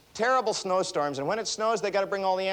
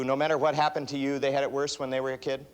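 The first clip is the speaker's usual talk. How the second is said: usual speaking voice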